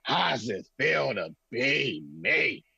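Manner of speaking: slow gloating quote from The Five Heartbeats; movie quote. Retold or imitated. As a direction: taunt him slowly like the movie scene. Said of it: "he looks at Flash and says".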